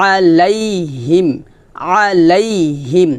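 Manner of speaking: আলাইহিম recited very slowly, each sound stretched; slow drawn-out recitation. Shown as a demonstration. Teacher to students, slow Quranic recitation. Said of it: "এখান থেকে বলি".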